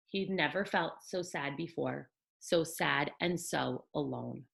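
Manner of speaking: put-on gentle read-aloud for children; storybook narration for little ones; bedtime story reading. A narrator's reading. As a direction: tell it like a picture book at bedtime.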